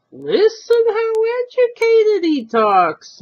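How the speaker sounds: put-on high, slow, impressed old man; admiring old man. Old man praising the stranger to his wife, admiring. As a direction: marvel at the fancy words. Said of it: "Mister Gumbiner said admiringly".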